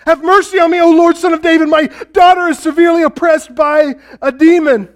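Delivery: a mother crying out for her daughter; desperate crying out. Retold or imitated. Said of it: "she kept screaming this".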